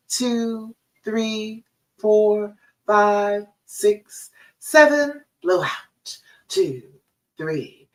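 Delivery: slow, counted breathing coach voice; guided breathing. Acted. Therapist guiding a client through four seven eight breathing, calm and measured.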